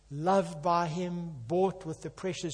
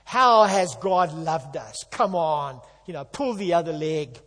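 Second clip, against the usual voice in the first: heckler; 6 dB louder than usual. Retold or imitated. Street heckler jeering back at Malachi, loud and mocking. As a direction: heckle the prophet from the crowd. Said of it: "some heckler in the crowd comes back and says".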